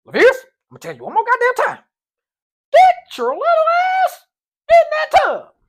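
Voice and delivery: very high, loud, fast coach shout; coach's voice. Retold or imitated. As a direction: bark it as the squinting coach, fed up. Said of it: "he talks like this".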